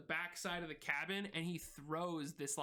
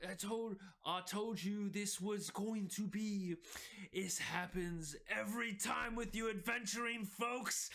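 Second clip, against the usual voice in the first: Izzy's corrected voice; 4 semitones higher than usual. Acted. Izzy scolds his sister and the adventurers, tired and higher. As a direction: scold the adventurers with weary scorn. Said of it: "that's not his way".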